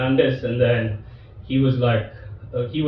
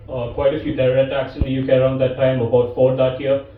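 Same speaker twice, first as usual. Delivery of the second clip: ordinary delivery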